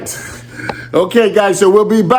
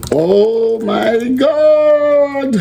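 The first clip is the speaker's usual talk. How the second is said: long, drawn-out higher "oh my God"; delighted exclamation; 10 semitones higher, much slower than usual. Real, not acted. Cook marvelling at the gravy, drawn-out delight. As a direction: stretch it out in delight. Gravy poured over the chicken.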